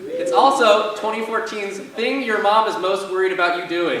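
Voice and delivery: ordinary delivery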